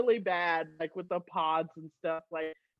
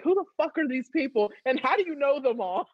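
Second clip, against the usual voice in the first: baffled husband; 6 semitones higher, 3 dB louder, faster than usual. Retold or imitated. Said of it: "And he's like".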